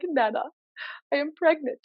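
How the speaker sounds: high, measured confession to Nana, mimicked; imitating a video character. Granddaughter telling her Nana she is pregnant, mock confession. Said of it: "I have no idea what accent that was".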